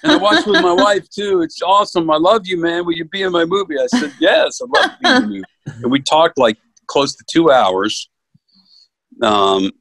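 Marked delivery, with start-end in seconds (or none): 0.0-3.8 high, excited retelling of a gushing call; retold gushing excitement. Director calling the actor after the audition, excited and high. 4.2-5.4 quick, high, eager yes; eager acceptance. Retold or imitated. Actor retelling how he accepted the role, quick and eager.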